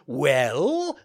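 loud villain prompt; menacing demand. Acted. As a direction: lean in, threatening. Assassins interrogate Pinocchio.

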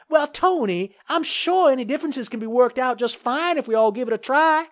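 very high, conciliatory character voice; smooth it over in a high voice; placating mayor. A narrator's reading. Mayor urging the developer to compromise, placating.

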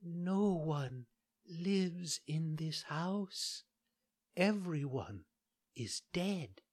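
put-on high, very soft and slow ghostly girl; barely there, as if already gone; dying maiden answers the puppet, faint and slow; faint, weak voice. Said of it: "With a voice so weak that it hardly could be heard".

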